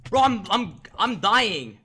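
loud panicked cry; panicking gamer. Real, not acted. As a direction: yell it as your health drains. Player losing the fight.

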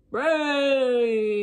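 eager zombie horde moan. Acted. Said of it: "moaned the zombies".

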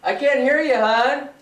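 high, loud call back. Real, not acted. Husband pretending not to hear his wife, loud call. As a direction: shout back teasingly.

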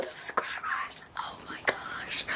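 breathless whisper of disbelief; stunned whisper. Real, not acted. Vlogger sees a new subscriber milestone, stunned whisper.

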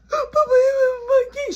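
high, loud, tearful cry; a mother sobbing over her son; crying plea. Retold or imitated. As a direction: beg through tears like a hurt grandmother. Grandma cries at dad.

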